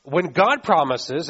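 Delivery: ordinary talk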